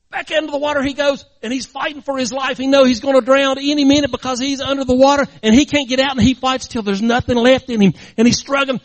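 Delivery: high, loud, breathless-struggle storytelling; urgent narration. A narrator's reading. Preacher telling of the boy drowning, urgent.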